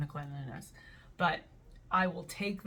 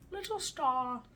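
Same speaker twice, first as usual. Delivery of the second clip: "Little star" said in a sweet put-on tone; a touch of a cute voice on "Little star"; cutesy quote; 6 semitones higher, slower than usual. Real, not acted. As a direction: read it with a wink. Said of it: "quoting the label".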